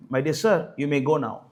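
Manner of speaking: politely voiced doctor, low and soft; polite dismissal. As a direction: gently show a patient out, mildly courteous. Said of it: "That is a polite way of saying".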